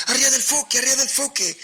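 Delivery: high, loud echoed shout from the crew; sailors shouting back. A narrator's reading. Sailors shouting the captain's order back, loud high shout. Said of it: "Y todos los marineros gritaban".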